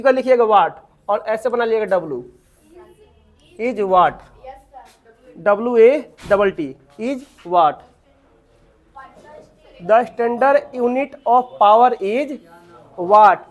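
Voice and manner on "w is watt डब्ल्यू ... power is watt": very slow, measured dictation. Real, not acted. Teacher dictating the unit of power to students, slow dictation. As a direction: leave time to write each word.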